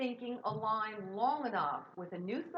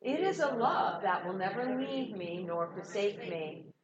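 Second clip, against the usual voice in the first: loud, slow recited affirmation; group affirmation; 4 dB louder, slower than usual. Acted. Minister leading congregation in prayer, loud slow recitation. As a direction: recite it together with devotion.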